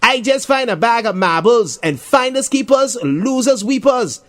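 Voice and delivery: high singsong taunt, slowing on the rhyme; childish mocking chant. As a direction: tease him like a playground bully. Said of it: "Jason taunts Darren".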